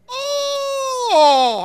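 long high hammed-up "oh"; awestruck teenager. Teenagers watching Jesus rise, hammed-up awe. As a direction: wail it in exaggerated amazement. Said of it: "I can see the teenagers".

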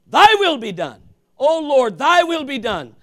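fervent prayer. Real, not acted. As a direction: cry out surrender to the Lord. Preacher proclaims prayer loudly.

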